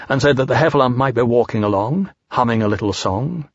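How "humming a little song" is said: plain reading voice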